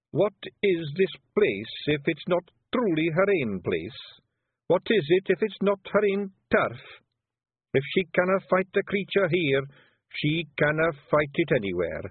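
hesitant broad Scots, pleading a little; a shy Feagle asking his chief not to scold; nervous Scots Feagle. Acted. Willie explains idea.

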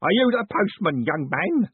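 put-on loud, high old man's bellow. A narrator's reading. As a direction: bellow it right in his face.

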